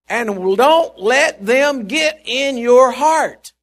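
rising preacher intensity; urgent preaching. Acted. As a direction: press each word home slowly. Preaching build to climax.

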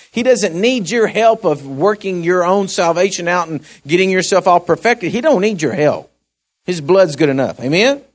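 high, loud pulpit exhortation; full-force preaching. Acted. Preacher exhorts the congregation that the blood is enough, full force. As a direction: drive it home to the congregation.

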